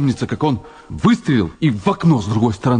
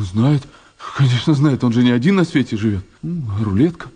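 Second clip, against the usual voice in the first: ordinary talk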